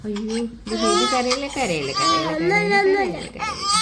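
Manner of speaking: high, urgent play-soothing; fussing over crying baby. Pretend. Hushing the crying baby doll.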